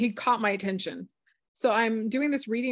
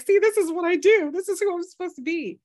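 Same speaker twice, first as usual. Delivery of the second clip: excited declaration; 10 semitones higher, 7 dB louder than usual. Retold or imitated. Triumphant self-affirmation, retold.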